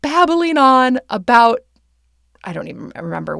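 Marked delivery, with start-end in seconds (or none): 0.0-1.6 loud, high mock complaint; slow, hammed-up irritated shout; upset commenter. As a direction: drag it out as an exasperated listener griping. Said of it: "But they were really upset".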